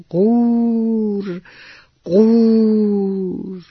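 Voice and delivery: high lingering imitated croak; frog croak. A narrator's reading. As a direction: imitate a frog with one slow, stretched croak. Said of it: "اون صدا رو دوباره شنیدن".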